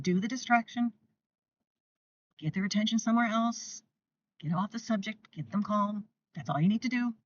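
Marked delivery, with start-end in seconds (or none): none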